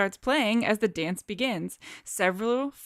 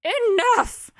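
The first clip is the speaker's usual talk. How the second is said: high, loud sudden snap; sharp shout; 13 semitones higher, 8 dB louder than usual. Acted. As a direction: cut them off sharply. Character shouts at sister.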